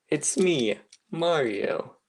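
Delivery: a quick playful Mario impression in the Italian accent. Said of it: "it's gonna be hard for me to do a Mario accent".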